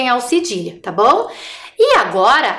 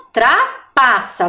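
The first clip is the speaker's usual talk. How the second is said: trapaça drawn out slowly for the class; slow and clear; much slower than usual. Shown as a demonstration. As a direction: say trapaça slowly so children follow. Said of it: "E a última". (trapaça dita bem devagar)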